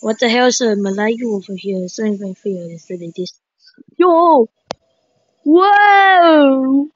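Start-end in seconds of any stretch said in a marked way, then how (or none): 4.0-6.9 long, high whoa; drawn-out exclamation of shock; amazed outcry. Real, not acted. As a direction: stretch it out, pitch raised. Amazed at game boss.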